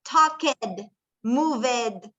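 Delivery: "talked, moved" with a full -ed syllable, talk-ed, mov-ed; mispronounced -ed endings. Shown as a demonstration. Teacher shows class the common error, "talked, moved" with -ed fully sounded. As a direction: say "talked, moved" as two syllables each, Spanish-style. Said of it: "Muchos de nosotros decimos".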